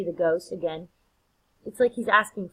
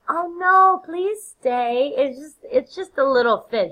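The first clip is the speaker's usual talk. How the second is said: high, loud, overdone hostess reassurance; placating Lady Macbeth; 8 semitones higher, 8 dB louder, slower than usual. Acted. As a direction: plead brightly with guests to stay. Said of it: "Lady Macbeth's like".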